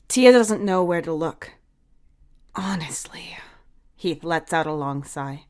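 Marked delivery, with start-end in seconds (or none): 2.6-3.4 exasperated whisper. Acted.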